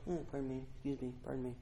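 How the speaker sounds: soft, timid pardon me; meek murmur, afraid to bother; overly polite. Retold or imitated. A timid person politely seeking attention, soft and meek. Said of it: "It's the cry you use".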